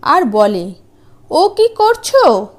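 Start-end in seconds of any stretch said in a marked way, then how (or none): 1.3-2.5 high, slow, puzzled question; surprised giant. Acted. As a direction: ask it slowly, bewildered. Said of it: "অবাক হয়ে চেয়ে দেখে".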